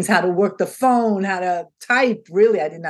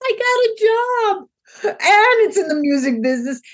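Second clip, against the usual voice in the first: reliving the moment of getting the job; thrilled; 13 semitones higher than usual. Retold or imitated. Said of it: "I was so thrilled".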